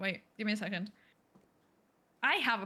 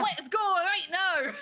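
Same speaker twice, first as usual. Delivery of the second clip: slow, higher, louder Scottish push; rally them in a hammed Scottish accent; Scottish accent attempt; 7 semitones higher, 4 dB louder, much slower than usual. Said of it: "try to do a Scottish accent".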